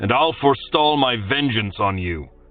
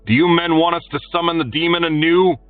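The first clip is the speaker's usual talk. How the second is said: higher, louder, fast bellow; 12 semitones higher, 4 dB louder, faster than usual. A narrator's reading. Arlian bellowing a threat at the guardsmen. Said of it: "Then he bellowed".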